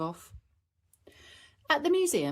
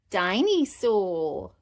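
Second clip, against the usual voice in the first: put-on toddler voice; 2 semitones higher, 5 dB louder, much slower than usual. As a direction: draw the word out like a little boy. George, toddler voice.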